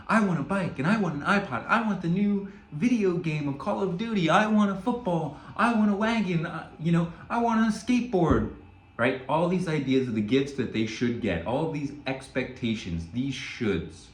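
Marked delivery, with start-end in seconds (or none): 0.1-8.5 put-on high, eager kid voice listing toys; eager kids' wish list. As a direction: play a greedy excited child. Kids listing Christmas wishes.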